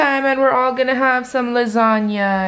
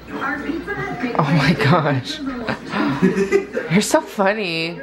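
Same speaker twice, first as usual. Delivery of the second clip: laughing; much slower than usual. Real, not acted. Laughs at a joke.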